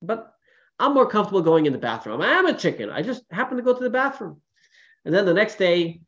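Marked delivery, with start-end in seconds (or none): 0.0-4.4 high, breezy and matter-of-fact; farmer chicken. Retold or imitated. Farmer explaining to the prince, high and breezy. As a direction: shrug it off lightly. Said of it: "He says".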